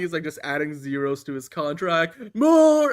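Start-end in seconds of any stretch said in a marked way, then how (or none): 2.4-2.9 high, loud imitation of a film yell; mock movie-villain shout. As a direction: bellow it in mock command, pitched up. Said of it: "where he's like".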